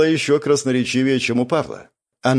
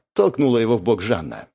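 usual speaking voice

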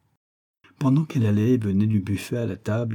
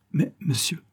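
higher, hesitant protest; startled surprise; 8 semitones higher than usual. A narrator's reading. Girl to unknown man at the stove, startled. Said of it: "l'attitude de la surprise".

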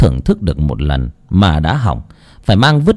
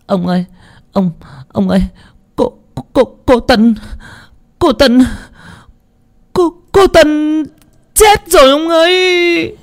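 stammering in panic. Acted. Cook telling her master the girl died, high and stammering. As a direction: stammer out the news, terrified. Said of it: "lắp bắp".

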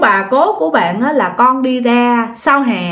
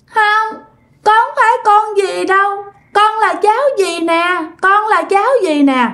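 put-on high, sing-song toddler spirit, tearful; tiny child voice; 8 semitones higher than usual. A narrator's reading. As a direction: plead in a little child's high voice. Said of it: "nói cái giọng nhỏ xíu". (giọng con nít nhỏ xíu, như mếu khóc)

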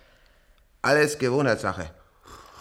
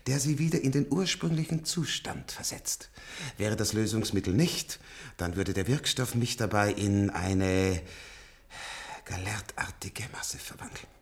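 low whisper; 4 semitones lower than usual. Acted. Old patient confesses to the nurse in a low whisper. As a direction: murmur it like a confession. Old man's hushed litany.

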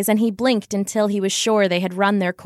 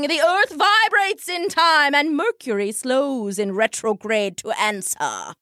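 grand, higher soothsayer intoning; 5 semitones higher than usual. Acted. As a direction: intone it to the whole crowd. Madame's mystic prophecy.

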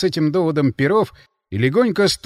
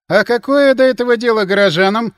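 raised, louder puzzled question; surprised; 8 semitones higher, 8 dB louder than usual. A narrator's reading. Гельман questions why townspeople would care, surprised. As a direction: ask it with real surprise in the voice. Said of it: "удивился Гельман".

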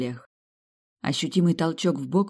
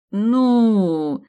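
long drawn-out hesitant well; 6 semitones higher, 8 dB louder than usual. A narrator's reading. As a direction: stretch the word out, unsure. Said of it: "протянул Боленброк".

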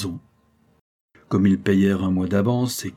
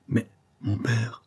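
panting; 2 semitones higher, 4 dB quieter, slower than usual. A narrator's reading. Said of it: "haletait Robert".

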